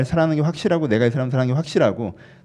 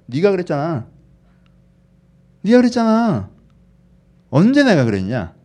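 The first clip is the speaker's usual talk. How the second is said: mock angry God, getting louder; angry retort; 4 semitones higher, 5 dB louder than usual. God snapping back at the complaining believer, angry. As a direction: build into an indignant, loud comeback. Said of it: "화내실 거예요".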